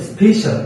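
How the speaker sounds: স্পেশাল high, as "SPE-shal", falling at the end; pronunciation example. Shown as a demonstration. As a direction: model স্পেশাল with a high first syllable. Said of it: "কি হতে পারে?". (স্পেশাল উঁচু স্বরে, উচ্চারণ দেখিয়ে)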